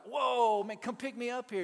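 loud, high astonished whoa; amazed. Retold or imitated. Preacher stunned as every inmate responds, loud and high. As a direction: blown away by the crowd.

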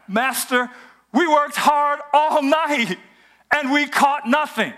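high, drawn-out exasperated objection; speaking up in protest. Acted. Simon objecting to Jesus after a fruitless night, speaking up. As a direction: raise the pitch and plead the tired fisherman's case. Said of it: "now he's speaking up".

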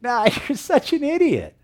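high, loud sneering laugh; mocking laugh. Mourners scoffing at Jesus, high and laughing. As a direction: laugh him off as an idiot. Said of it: "they all ridiculed him".

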